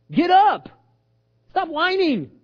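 high, loud command; sharp rebuke. Acted. God telling Joshua to get up, sharply rebuking.

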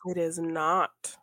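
ordinary talk

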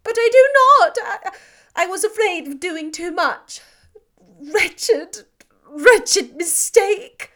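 anguished regret. Acted. Elizabeth reproaching herself before Darcy, high anguished wail. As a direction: wail over her own mistake.

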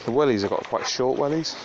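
ordinary delivery

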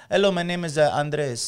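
mock Spanish heartthrob accent. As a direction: introduce yourself with a hesitant Spanish accent. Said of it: "he's got the Spanish".

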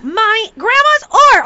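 put-on slow, loud, high kid complaint; mad child. Five-year-old mad at her grandmother's dripping oar, whiny. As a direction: whine loudly as an annoyed five-year-old. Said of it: "She's just mad".

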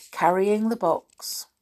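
ordinary delivery

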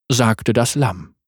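ordinary delivery

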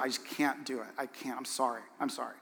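very soft, low, resigned; acted regret, nearly fading away; pained apology. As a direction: imitate a hurt wife apologizing, hushed and low.

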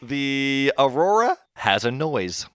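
drawn-out, deliberate quiz-question read; slow quiz reading. Acted. Host reading a quiz question, slow and deliberate. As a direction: read the question slowly, like a game show.

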